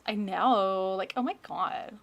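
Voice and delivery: mock Valley Girl drawl. Joking with chat about annoying voices, mock Valley Girl. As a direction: stretch the vowels like a Valley Girl. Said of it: "It's worse than the Valley Girl voice".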